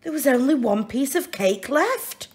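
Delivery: ordinary delivery, nothing marked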